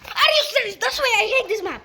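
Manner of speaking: high, loud exasperated complaint; frustrated outburst. Real, not acted. Gamer griping at his team about the map, exasperated. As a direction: vent about the map, pitch up.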